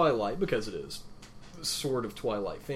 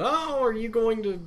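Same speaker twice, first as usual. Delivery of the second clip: high, chirpy retelling of a nosy question; mock innocent question; 9 semitones higher than usual. [name] casually asking her friend about lunch, mock innocent. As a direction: put on a sing-out, higher-pitched friendly inquiry.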